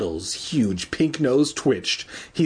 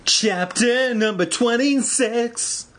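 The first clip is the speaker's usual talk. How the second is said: high, slow, drawn-out announcer voice; hammed-up sing-out, stretching each word; chapter announcement; 11 semitones higher, slower than usual. Reader announces the next chapter after a botched attempt, hammed-up announcing.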